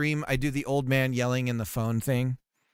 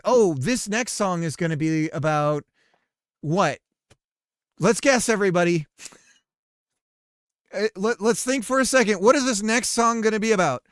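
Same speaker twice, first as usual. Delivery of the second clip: loud, high, teasing DJ build-up to the guess; hammed-up radio host; 6 semitones higher, 8 dB louder than usual. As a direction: quiz the listeners like a cheesy host.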